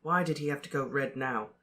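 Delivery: ordinary delivery, nothing marked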